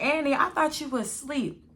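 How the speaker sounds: lightly voiced surprised mother; mom's voice. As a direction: play the surprised mom. Mom voiced, higher, louder.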